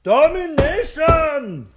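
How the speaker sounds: hammed-up evil mastermind, bigger still; mock villain. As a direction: stretch it out grandly like a cartoon villain. Doctor Loeb repeats.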